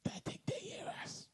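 hushed whisper. Real, not acted. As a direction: whisper so no one hears. Sneaking, fear of being heard.

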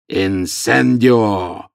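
loud, very drawn-out incantation; huge attacker bellowing a spell slowly; roared spell. A narrator's reading. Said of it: "огромный пожиратель смерти рявкнул за его спиной". (протяжный громкий рёв заклинания)

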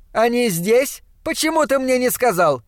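put-on high-pitched, indignant young girl; indignant ringing girl's voice. A narrator's reading. Girl scolds her uncle for not telling her, indignantly. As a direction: demand an explanation in a high, ringing voice. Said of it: "звонким голосом, без всякого акцента, выразила своё негодование".

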